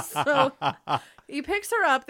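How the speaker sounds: ordinary delivery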